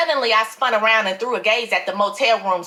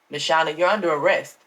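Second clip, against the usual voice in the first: lower, calm detective voice; Daryl; 7 semitones lower than usual. A narrator's reading. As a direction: state the arrest flatly, head shaking. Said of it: "Daryl said, shaking his head".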